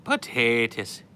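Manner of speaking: slow, loud mock-Irish brogue; mock Irish accent. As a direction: ham up an Irish accent, drawn out. Mock Irishman saying potatoes.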